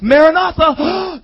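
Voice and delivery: high, loud, drawn-out cry; rallying cry. Acted. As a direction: cry out the watchword slowly. Paul acted rallying with the watchword.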